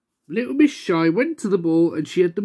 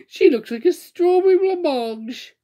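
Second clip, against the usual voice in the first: high, slow nasty taunt; mock her to her face; cruel giggle; 10 semitones higher, 3 dB louder than usual. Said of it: "giggled ... cruelly".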